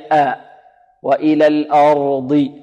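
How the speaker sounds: pure آ, then thick ra in الأرض; correct light hamza. Shown as a demonstration. Teacher models the right form, balanced fatha. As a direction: say الأرض slowly with clear light ah. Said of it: "وإنما هي".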